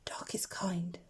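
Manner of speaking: soft whispered reassurance to oneself; muttered to himself. A narrator's reading. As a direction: whisper it to calm your own fear. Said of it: "Plop muttered to himself".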